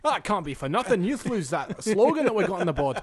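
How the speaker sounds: a Scottish clerk defending the spending, laughing; Scottish accent. Pretend. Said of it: "You're pretty good at that accent".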